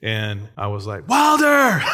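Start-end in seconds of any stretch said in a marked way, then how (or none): 1.1-1.8 put-on high, loud yell at a child in danger; retold panicked yell. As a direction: yell the name out as if stopping a runaway toddler. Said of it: "I would yell".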